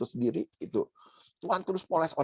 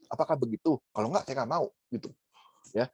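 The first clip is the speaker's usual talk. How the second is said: ordinary delivery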